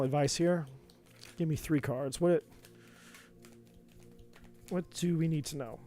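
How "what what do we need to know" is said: usual speaking voice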